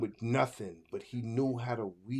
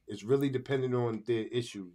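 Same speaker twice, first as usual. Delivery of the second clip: usual speaking voice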